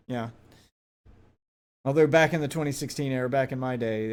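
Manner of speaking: ordinary delivery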